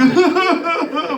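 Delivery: high-pitched mock wailing sobs; bawling blubber. Showing how he cried on the video, mock bawling. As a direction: imitate loud, blubbering crying. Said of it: "full-on bawling blubber".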